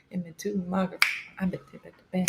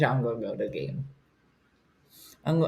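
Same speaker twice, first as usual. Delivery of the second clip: ordinary talk, nothing marked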